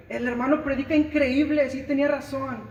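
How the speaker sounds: hammed-up gushing, high and loud; high, loud mock-raving over the preacher; mock enthusiasm. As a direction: parody a listener raving about the sermon. Mocking enthusiastic sermon listener.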